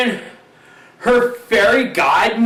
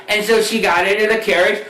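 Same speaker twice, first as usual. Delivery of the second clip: usual speaking voice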